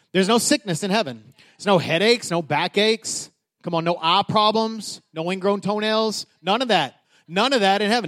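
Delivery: ordinary delivery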